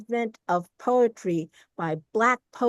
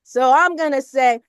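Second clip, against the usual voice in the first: ordinary delivery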